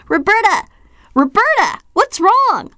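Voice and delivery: high, loud panicked cry; freaking out. A narrator's reading. Sister panicking at Roberta's drooling fit, loud and high. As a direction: call out her name in alarm. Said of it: "will suddenly freak".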